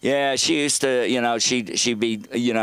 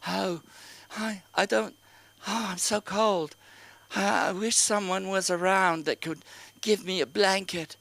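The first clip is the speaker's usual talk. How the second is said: high, soft, put-upon whimper; guilt-trip like a pitiful old mother; softer, higher mock-feeble mom voice; passive-aggressive mom; 8 semitones higher, 5 dB quieter than usual. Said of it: "she'd be very passive-aggressive".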